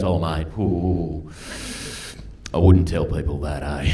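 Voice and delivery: low, drawn-out cautioning aside, retold; wary warning. Mate warns comedian not to tell people that, low wary warning. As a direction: imitate a mate's slow, low, quiet warning.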